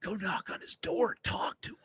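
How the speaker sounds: tempting whisper inside his head; inner voice whisper. Retold or imitated. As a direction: breathe it like a little devil on his shoulder. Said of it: "all I heard in my head was".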